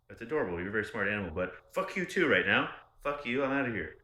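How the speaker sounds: starts sweet to the dog, ends in a loud angry yell; telling off his ex on the way out, sweet to the dog, then a loud angry mock yell. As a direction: coo at the dog, then blow up. Retold talking to dog, angry.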